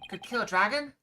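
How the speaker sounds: loud defiant challenge; defiant shout. Acted. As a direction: throw it back with force. Wounded hero defies attacker.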